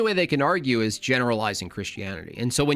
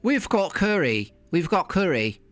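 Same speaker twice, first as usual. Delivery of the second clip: mock Londoner; 4 semitones higher than usual. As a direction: parody a proud Londoner bragging about curry. Mocking the London caller.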